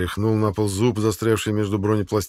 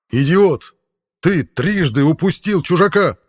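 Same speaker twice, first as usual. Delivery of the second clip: higher, louder, slower rage; furious shout; 9 semitones higher, 8 dB louder, slower than usual. Acted. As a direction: bellow at the failed subordinate. Officer beats his subordinate.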